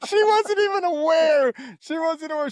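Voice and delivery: high, loud disbelief; incredulous. Real, not acted. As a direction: exclaim it in disbelief. Incredulous outburst.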